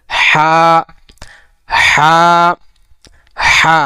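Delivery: হা squeezed from mid-throat, vowel held long; pressed throaty ha. Shown as a demonstration. Teacher demonstrates the Arabic letter হা, squeezed from mid-throat. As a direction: tighten the middle of the throat on each drawn-out হা. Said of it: "ওইখান থেকে একটু চেপে ধরে".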